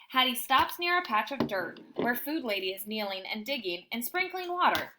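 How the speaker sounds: ordinary delivery, nothing marked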